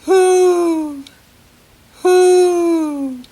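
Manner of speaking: high, loud drawn-out hoo; owl hoot. Shown as a demonstration. Teacher voicing an owl for children, loud high hoots. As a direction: call out like an owl at night. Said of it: "what does the owl say?".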